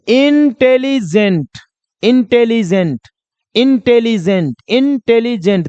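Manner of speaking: "Intelligent" in three distinct chunks, slowest first; teacher sounding out "intelligent" part by part; split into three beats. Shown as a demonstration. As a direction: break "intelligent" as in-telli-gent, repeating it. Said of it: "तीन बार में बोलाएगा".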